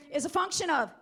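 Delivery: loud, high call to the crowd; rally the room with it; shouting call-out. Real, not acted.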